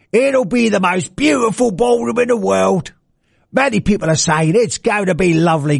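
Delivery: Trump as a cheeky Brit, put on a British accent. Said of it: "So Trump would sound very different".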